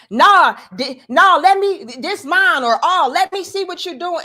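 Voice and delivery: high, loud mock girlfriend voice; imitating Keke. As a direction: play her snatching the phone back. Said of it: "And she's like".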